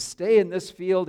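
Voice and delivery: insistent, lifted stay; emphatic call. Retold or imitated. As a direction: press her warmly to stay. Boaz calls her emphatically.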